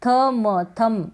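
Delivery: थम drawn to a close on M, no B; stop on the M. Shown as a demonstration. Teacher drilling learners on silent B, थम closed at M. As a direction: end each थम on the M. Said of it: "म पे ही रुक जाना है आपको".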